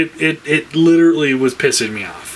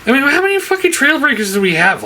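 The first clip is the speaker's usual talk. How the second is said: much higher and fast, fed-up question; exasperated rant; 11 semitones higher, faster than usual. Real, not acted. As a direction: fire it off in disbelief. Ranting about Trailbreaker reissues.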